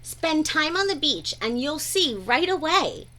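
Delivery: ordinary delivery, nothing marked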